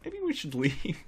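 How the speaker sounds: nervous cops. Retold or imitated. Cops in the lobby decide to flee, timid voice. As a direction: squeak it like a scared bystander. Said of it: "Everyone's just like".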